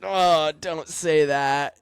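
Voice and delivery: put-on high, loud, drawn-out plea of a scared kid; a worried young driver begging the crew member not to say it; pleading dismay. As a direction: beg him not to say it, stretching it slow. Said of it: "And I'm like".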